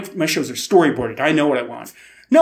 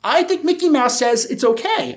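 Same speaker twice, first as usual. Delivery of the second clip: higher, cartoon mouse voice; mock Mickey Mouse voice; 7 semitones higher than usual. Telling the TV people Mickey approves, in a mock mouse voice. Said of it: "And I said".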